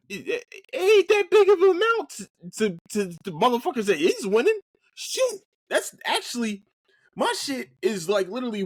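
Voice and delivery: high, loud outburst; angry rant. Real, not acted. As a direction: spit it out in disbelief. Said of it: "I'm just angry".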